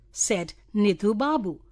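usual speaking voice

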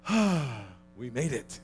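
loud exhaled sigh, then the words; mock sigh of relief. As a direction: heave a big sigh as if you barely survived. Acting supervisor's relief on arriving.

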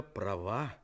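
usual speaking voice

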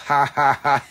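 sarcastic forced ha ha ha; fake laugh. Mocking a viewer's joke with fake laughter. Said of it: "you're making me laugh".

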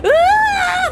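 high, loud drawn-out wail; mock ghost wail. Player mocks ghosts that never explain, exaggerated high wail. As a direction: wail like a ghost who only knows its grievance. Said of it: "막 이러고".

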